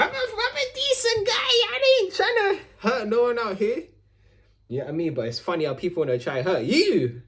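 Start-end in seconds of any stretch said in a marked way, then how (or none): 0.0-3.8 high, slow fake sob; hammed-up tearful plea; mock crying. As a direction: whimper it like a wounded innocent. Mock-sobbing self-pity.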